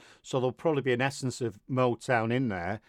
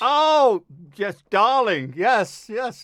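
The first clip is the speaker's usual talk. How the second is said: loud, high posh-English gush; hammed-up posh English accent; 7 semitones higher, 8 dB louder, slower than usual. Host answers guest's wish for a posh accent, mock posh English. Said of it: "really posh English".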